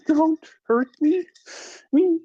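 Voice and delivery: high, frightened little voice asking; scared questioning girl. Acted. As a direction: plead timidly like a terrified child. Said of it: "she says in a questioning tone".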